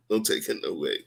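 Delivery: usual speaking voice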